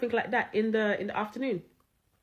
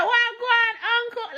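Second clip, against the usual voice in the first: high, hearty patois greeting; mimicked patois hail, pitched way up; Jamaican patois; 10 semitones higher, 4 dB louder, slower than usual. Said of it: "I agreed to them in patois".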